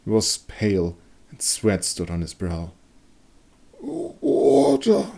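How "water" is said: much higher, weak pleading voice; parched wounded man begging. A narrator's reading. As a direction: beg for water feverishly. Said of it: "he begged".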